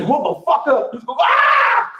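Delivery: high, loud laughing yell; mock startle shout. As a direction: scare him like pulling a gun. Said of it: "I said".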